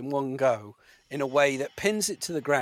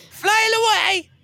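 laughing high, taunting the worm; 7 dB louder than usual. Acted. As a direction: cackle it out like a taunt. Mocking the worm.

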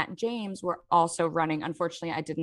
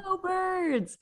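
fan cheer; 11 semitones higher, 3 dB quieter than usual. Retold or imitated. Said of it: "he goes".

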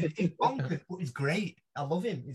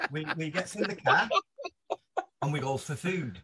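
ordinary delivery, nothing marked

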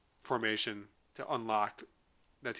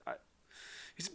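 ordinary talk

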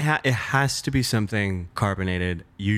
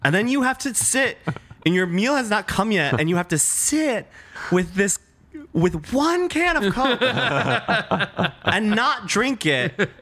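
high, laughing mock fury; laughing outrage; 9 semitones higher than usual. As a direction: rant it up high through laughter. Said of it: "I have never felt so angry".

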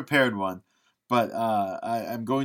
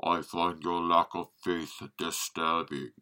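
low, quiet, menacing Darth Vader voice; slow deep menace, a fan's attempt at Vader; Darth Vader impression; 6 semitones lower, 5 dB quieter than usual. A podcast host attempts his Vader for the co-host, low menacing voice. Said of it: "with the caveat, the, the breathing will sound good".

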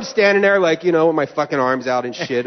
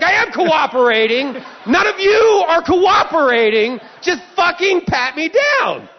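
high, loud, drawn-out shout; re-enacted blow-up at airport staff; outraged yelling; 12 semitones higher, 4 dB louder, slower than usual. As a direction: yell each word slowly in exasperation. Retold quarrel, yelling at screeners.

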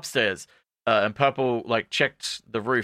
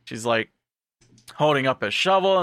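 usual speaking voice

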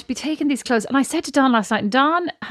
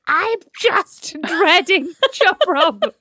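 laughing, higher mock whine, drawn out slowly; 8 semitones higher, 3 dB louder, much slower than usual. Retelling to her sister how she whined at Don, laughing higher mock whine. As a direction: whine it through a laugh, much higher and slow.

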